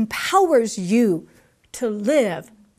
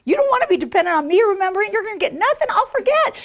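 rising excited warning; 9 semitones higher, 3 dB louder, much faster than usual. Real, not acted. Self-mocking forgetful pastor.

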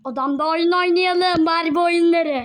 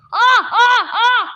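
loud laughing burst; 3 semitones higher, 6 dB louder, much slower than usual. Acted. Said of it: "biraz fazla güldüm".

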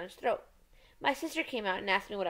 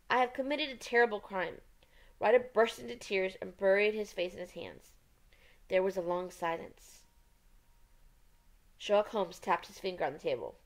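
plain reading voice

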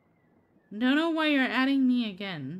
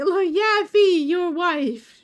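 calling out; 7 semitones higher, 9 dB louder than usual. Real, not acted. Calling V to look at chat.

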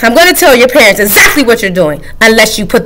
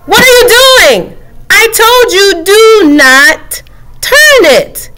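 much higher, slow indignant protest; the book character, high and outraged; 11 semitones higher, slower than usual. Acted. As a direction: scold the reader in disbelief.

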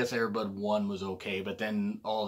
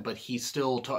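ordinary talk, nothing marked

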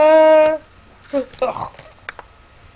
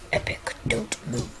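hushed whisper to the character; tense gaming whisper; 7 dB quieter than usual. Real, not acted. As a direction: breathe it, don't wake anyone.

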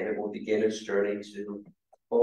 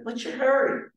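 mock yell; 13 semitones higher than usual. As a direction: imitate calling out to passers-by. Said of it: "he yells out to them".

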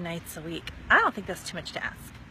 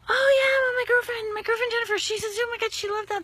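high, louder, fast gushing friend voice; gushing girlfriend; 13 semitones higher, 6 dB louder, faster than usual. Retold or imitated. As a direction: play the friend bubbling with enthusiasm.

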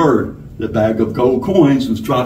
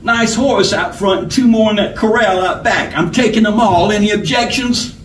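higher-pitched, gloating robber; smug outlaw claiming his prize; lip-smacking satisfaction; 8 semitones higher than usual. A narrator's reading. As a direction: relish the haul. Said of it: "said with obvious lip-smacking satisfaction".

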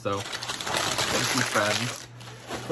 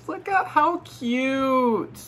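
high, slow coo; 12 semitones higher, much slower than usual. Real, not acted. Vlogger cooing at ceramic kittens, high and slow. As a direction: coo at something adorable.

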